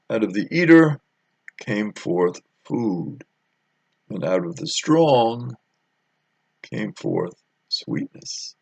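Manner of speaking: slow, deliberate riddle with long pauses; solemn riddle recitation. Shown as a demonstration. Preacher slowly reciting Samson's riddle to congregation. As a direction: intone the riddle slowly, weighing each line. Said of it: "And his riddle is this".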